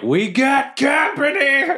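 high, drawn-out holler; yelled warning. Acted. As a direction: holler back to the whole band. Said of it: "I'm gonna yell back".

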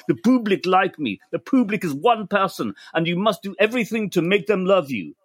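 quoting a French singer in a hint of a French accent; gentle mock French accent. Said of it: "the French accent is much more convincing".

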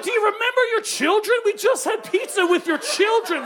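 high-pitched mock dismay; incredulous scolding. Guest reminds father of his children, incredulous scolding. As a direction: plead in disbelief, voice pitched well up.

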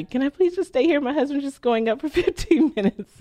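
high, pleading ask. Retold or imitated. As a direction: beg the parking attendant sweetly. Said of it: "begging the, the parking guy".

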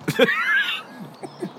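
high-pitched laugh; burst of laughter. Real, not acted. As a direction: let out a sudden high laugh. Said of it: "Why are you laughing at that?".

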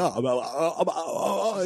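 a stranger left speechless by a Bruins fan; mock flustered stammer. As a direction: play a man caught with no comeback.

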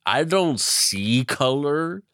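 put-on slow, high drunken declaration; hammed-up tipsy self-righteousness; Woodrow Wilson as happy drunk. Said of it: "your favorite happy drunk".

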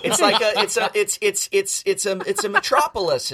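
high, loud, laughing disbelief; incredulous defense. Real, not acted. Defending Chicago to a friend, high incredulous sputter. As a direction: sputter in outrage at the slight.

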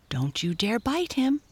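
lightly voiced pony giving a warning. A narrator's reading. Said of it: "said Tinkle to Dido".

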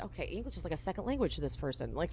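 quiet, fast aside under the breath; muttered aside. Retold or imitated. Bethany muttering about Jules, quiet and fast.

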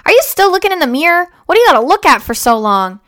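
mildly mocking dad, fast and pushed up; dad's voice. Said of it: "and then he goes".

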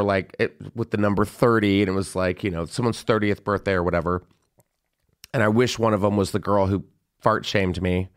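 ordinary talk

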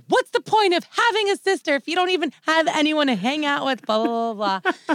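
high, loud mock teenage complaint; re-enacted indignant outburst; arguing with parents. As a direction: whine it in protest, trailing into blahs. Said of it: "I would always fight with my parents".

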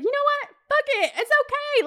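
agreeing to go to the party after drinks, giddy and high; tipsy carefree yes. Retold or imitated. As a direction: toss caution away with a bright high voice. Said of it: "And I was like".

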